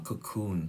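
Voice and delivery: "cocoon" with the stress on KOON; stress on second syllable. Shown as a demonstration. Teacher pointing out word stress to students, "cocoon" stressed on the second syllable. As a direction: softly model "cocoon" as kuh-KOON. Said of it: "stress ที่สองเนาะ".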